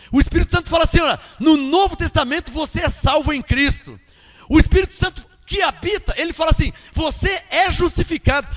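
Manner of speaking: loud, high, driving sermon delivery; emphatic preacher building point on point; full-force preaching. Real, not acted.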